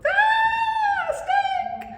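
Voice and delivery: high, loud shriek of fright; panicked yelp at a snake; scared scream. Retold or imitated. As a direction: shriek it like a terrified five-year-old. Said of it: "ran away screaming like my little five-year-old".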